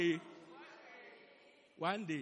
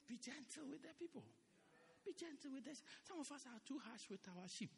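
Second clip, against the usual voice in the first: much softer, earnest plea; soft pleading; 14 dB quieter than usual. Real, not acted. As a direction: drop the voice and plead gently. Pastor softly pleading for gentleness.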